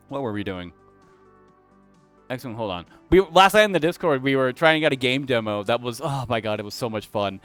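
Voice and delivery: ordinary delivery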